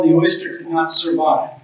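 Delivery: ordinary talk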